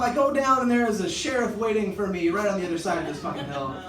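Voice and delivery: usual speaking voice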